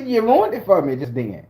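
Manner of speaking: usual speaking voice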